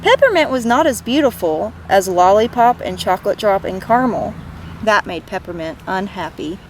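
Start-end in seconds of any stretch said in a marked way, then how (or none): none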